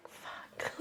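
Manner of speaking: breathless whispered swear; whispered curse. Retold or imitated. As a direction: mouth it in stunned defeat. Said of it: "are like".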